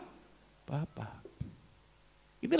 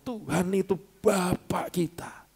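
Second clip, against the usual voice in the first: hushed whisper; slower than usual. Acted. Preacher confides to congregation.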